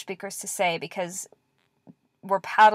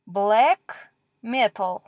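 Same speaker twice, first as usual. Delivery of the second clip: slow sounding out; much slower than usual. Shown as a demonstration. Teacher sounding out a word slowly.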